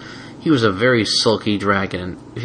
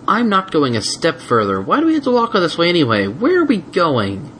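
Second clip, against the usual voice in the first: high whining protest; baby dragon refusing a step more; whiny refusal; 8 semitones higher than usual. Acted. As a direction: dig in and whine. Spike whimpering refusal.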